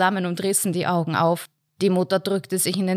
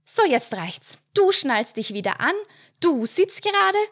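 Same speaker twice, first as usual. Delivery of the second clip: higher strict mother's order; stern; 9 semitones higher than usual. A narrator's reading. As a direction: lay down the law firmly. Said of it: "wies die Mutter die Kinder streng an".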